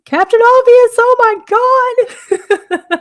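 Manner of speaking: high, slow delighted squeal; excited greeting. Real, not acted. Excited greeting of returning viewer.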